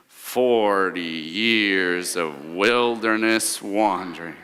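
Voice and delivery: low, slow, fed-up groan; annoyed groan. Shown as a demonstration. Congregation chanting the wandering years, annoyed and groaning. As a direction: drag it out like a worn-out mom. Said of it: "That's the voice that we gotta do".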